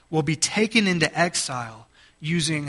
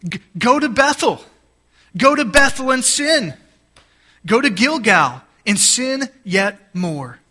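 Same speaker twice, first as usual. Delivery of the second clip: high, loud mocking invitation; biting sarcasm; 6 semitones higher, 4 dB louder than usual. Shown as a demonstration. God taunting his hypocritical people, biting sarcasm. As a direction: taunt them with mock welcome, dripping sarcasm. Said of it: "I want you to hear the sarcasm that is in God's voice".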